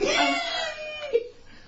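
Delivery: high screeching burst of laughter. Real, not acted. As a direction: shriek with laughter. Laughing at cohost.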